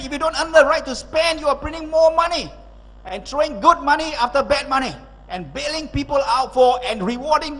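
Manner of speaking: high-pitched, impassioned speaker's rant; energetic, excited. Real, not acted. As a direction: drive the point home with high, excited energy. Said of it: "I sound very energetic and, uh, excited".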